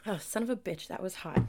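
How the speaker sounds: reacting to a hot sip; burnt by hot tea. Real, not acted. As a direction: mouth just scalded by tea. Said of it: "Excuse me while I drink this".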